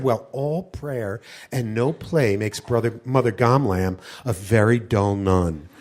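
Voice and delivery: put-on low, slow, serene old nun; a deadpan holy nun brushing him off. Mother Gamlam answering calmly in a low, slow voice. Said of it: "She said".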